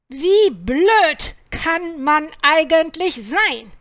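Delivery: loud, drawn-out scolding question; indignant farmer shouting; exasperated call. A narrator's reading. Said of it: "rief Bauer Anton".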